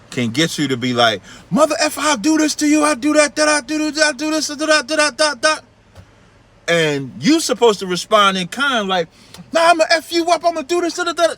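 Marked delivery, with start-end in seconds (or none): 1.5-5.6 high-pitched mock rant at someone; mock raging guy. Mimics someone losing control. 9.5-11.3 high, hammed-up threat back; mock tough comeback. Mimics the comeback in kind.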